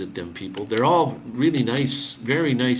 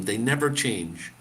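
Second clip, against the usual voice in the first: usual speaking voice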